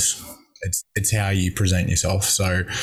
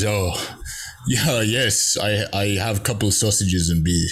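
literal European. Retold or imitated. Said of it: "so literal, European".

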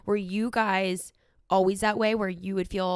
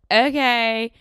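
loud, curt retold sign-off; mock exasperation; 4 semitones higher, 9 dB louder than usual. Daughter ending a retold call with mom, loudly exasperated. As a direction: cut the argument off loudly.

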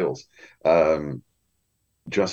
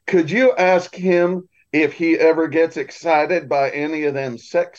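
higher-pitched, slow quote of a woman; imitated loud aside; 9 semitones higher, slower than usual. A grandmother asks her friend about the narrator, imitated loud aside. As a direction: ask a friend, pitched for the room. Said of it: "she said it loud enough for the".

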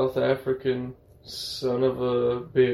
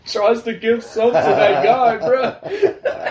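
higher and louder, laughing through the words; laughing hard; 10 semitones higher, 6 dB louder, slower than usual. Real, not acted. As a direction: tell it while cracking up. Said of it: "laughing through the story".

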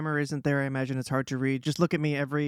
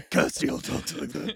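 lightly lowered gravel; Castiel voice; much slower than usual. Acted. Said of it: "there you go".

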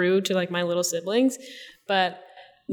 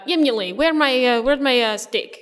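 higher, louder imitation of a Russian stepmother demanding her steak; a hammed-up Russian accent asking where the steak went; Russian-accented demand, pitched up; mock Russian accent; 5 semitones higher, 3 dB louder than usual. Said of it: "a Russian accent".

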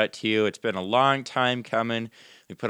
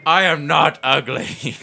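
high, slow, emphatic protest; indignant character; 4 semitones higher, 6 dB louder, slower than usual. Acted. Insulted orc answers the ugly jibe, indignant and slow. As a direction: deny it word by word, pitched up.

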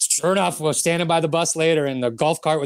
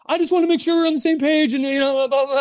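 mock Newstead, high; 10 semitones higher than usual.

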